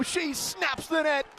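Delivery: ordinary delivery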